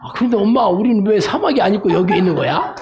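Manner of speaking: put-on high-pitched child asking mum; little one pestering mother with a question; baby camel. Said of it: "낙타 새끼가 엄마한테".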